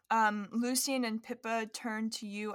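normal talking voice

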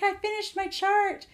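put-on high, slow, proud kid voice; proud announcement. Eleven-year-old telling mom he beat his brother, proud and high. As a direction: announce it like a trophy. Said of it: "he's like".

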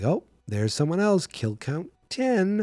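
high, slow announcing; excited announcer. Retold or imitated. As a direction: call out the count, drawn out. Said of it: "And you're like".

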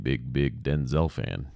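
usual speaking voice

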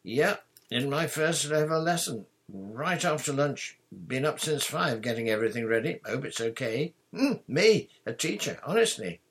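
eager. A narrator's reading. New teacher tells friends about his first lesson, eager. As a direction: bubble over with proud excitement. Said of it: "he said eagerly".

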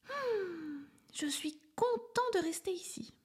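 high, hushed little elephant, pleased to stay behind; a small elephant murmuring contentedly. A narrator's reading. Little elephant talking to himself, high and hushed.

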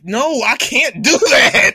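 high, loud, drawn-out no; loud slow refusal. Retold or imitated. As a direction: refuse the devil on your shoulder loudly. Refusing the evil brain voice.

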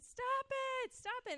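imitating a girl's delighted high squeal; very high, playful mock complaint; giggly protest. Girl smothered with kisses, giggly protest. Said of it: "she started laughing and then said".

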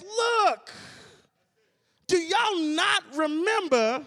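Caleb rebuking. Retold or imitated. Caleb rebuking the fearful people, stern shout. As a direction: rebuke them sharply. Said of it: "Caleb steps into the situation and says".

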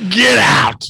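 high delighted yell; excited shout. Real, not acted. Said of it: "reacting to the read".

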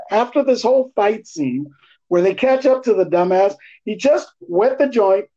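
ordinary talk

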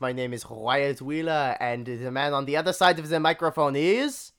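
loud, hammed-up French-accented welcome; bad French accent. Host welcoming listeners back to the podcast, bad French accent. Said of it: "This is supposed to be French".

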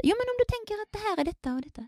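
high, quick, coaxing prompt; imitating an eager teacher's hint. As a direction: nudge the student along, voice pitched right up. Acts coaxing teacher prompting student.

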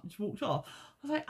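ordinary talk

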